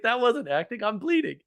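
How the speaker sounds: protest, not acting at all; insistent protest. [name] insists he's hurt.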